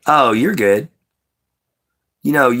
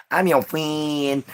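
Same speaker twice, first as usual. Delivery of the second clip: slow, softer, higher mock voice; put-on friend voice; 7 semitones higher, 5 dB quieter, slower than usual. Streamer to chat, repeating the friend voice softly. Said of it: "the reason why I did that voice".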